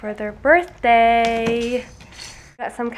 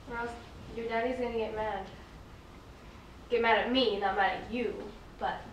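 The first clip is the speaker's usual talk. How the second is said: soothing mom; 10 dB quieter than usual. Real, not acted. Mom softly soothing her crying toddler.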